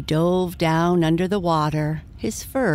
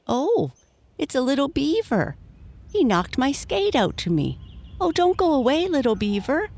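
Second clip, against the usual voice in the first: put-on high-pitched excited little girl; excited cry; 10 semitones higher than usual. A narrator's reading. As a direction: cry out eagerly, pitched well up. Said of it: "again and cried".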